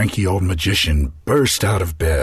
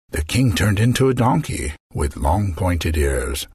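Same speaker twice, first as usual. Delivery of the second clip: plain reading voice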